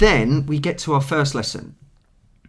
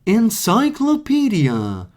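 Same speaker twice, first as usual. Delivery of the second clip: high, slow, over-enunciated CD narrator; mock textbook voice; 7 semitones higher, much slower than usual. Mocking the classroom CD, slow and high. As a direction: parody the stilted American recording.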